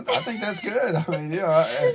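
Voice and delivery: laughing through the words; breaking into real laughter. Real, not acted. Co-host laughing as they wrap up the podcast, laughing.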